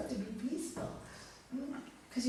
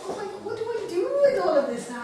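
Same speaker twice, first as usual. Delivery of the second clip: laughing overwhelm; 8 semitones higher, 8 dB louder, faster than usual. Retold or imitated. Overwhelmed by too many presents.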